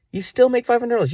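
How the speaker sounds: very high, strained squeak of disbelief. Real, not acted. Man stunned by his own maths, squeaking the sum very high.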